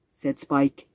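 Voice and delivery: ordinary delivery, nothing marked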